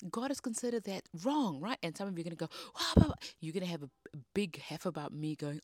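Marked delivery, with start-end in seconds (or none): 2.7-3.1 high, loud mock protest; blustering blah-blah, pitched way up; mock outrage. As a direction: imitate a huffy objector's babble. Mimics listeners' expected outraged huff.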